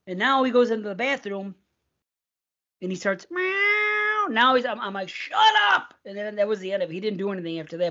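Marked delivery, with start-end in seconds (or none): 3.3-3.9 mock meow. Imitating the cat's meow. 5.1-5.9 sudden high shout at the cat; high, loud yell at the cat; yelled shut up. Retold or imitated. Yelling at the meowing cat.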